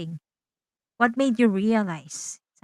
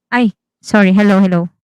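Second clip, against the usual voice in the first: call dropping out; 12 dB louder, faster than usual. Real, not acted. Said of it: "Yeah, I can hear you now".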